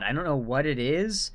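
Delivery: ordinary talk, nothing marked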